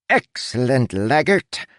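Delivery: high, grand aristocratic captain; lordly captain addressing an underling. A narrator's reading. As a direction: put on a pompous captain's voice. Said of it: "said in his captain voice".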